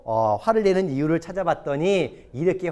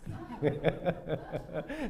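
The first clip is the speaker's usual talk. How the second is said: quiet run of chuckles; soft chuckle; 13 dB quieter than usual. Real, not acted. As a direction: laugh softly to yourself. Laughs after crediting his wife. (나직이 킥킥 웃음)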